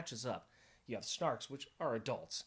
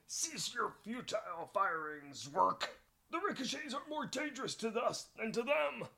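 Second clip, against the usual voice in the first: put-on made-up alien captain accent; 10 semitones higher than usual. Host as Snark captain yelling to cease firing, made-up accent. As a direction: yell it out in an improvised alien accent. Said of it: "I just made up that accent on the spot".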